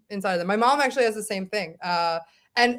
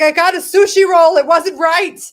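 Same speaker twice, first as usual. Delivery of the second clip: high, loud complaining mom; a mother fussing that the sushi was wrong; imitating her mother; 7 semitones higher, 12 dB louder than usual. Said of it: "she's like".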